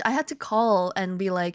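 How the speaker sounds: ordinary delivery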